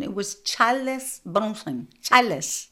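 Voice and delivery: Charles said in two syllables, "Char-les"; mom's pronunciation. Retold or imitated. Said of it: "broke down Charles to two syllables".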